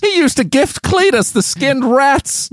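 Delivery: high put-on Deep South twang; Deep South accent. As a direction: join in as a Southern gossip. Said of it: "We've gone really deep south on this".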